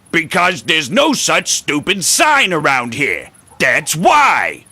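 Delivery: loud, high angry outburst; exasperated shout; mad. Acted. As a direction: snap it loudly, fed up with a silly question. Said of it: "No need to get mad".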